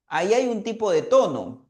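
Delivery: ordinary talk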